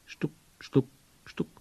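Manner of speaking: short, quick knock syllables; tapping noise made by voice; knocking imitation. A narrator's reading. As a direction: imitate three stealthy raps. Narrator resumes imitated knocking.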